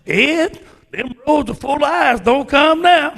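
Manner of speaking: high, loud pleading warning; girlfriend's warning. Retold or imitated. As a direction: warn him off the icy roads. Girlfriend voiced warning on phone.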